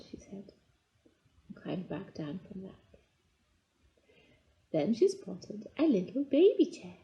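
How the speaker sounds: plain reading voice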